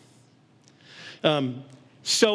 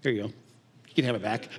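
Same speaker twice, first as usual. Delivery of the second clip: lower, softer, breezy dismissal; handing it back; 8 semitones lower, 4 dB quieter than usual. Retold or imitated. Couple handing the hurt back to each other, lower and breezy. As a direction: toss it back without a second thought. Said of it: "We just kinda went".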